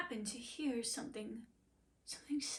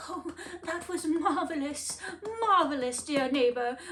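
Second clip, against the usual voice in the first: high, louder gushing praise; delighted donkey; 5 semitones higher, 9 dB louder than usual. Acted. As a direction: rave about the song.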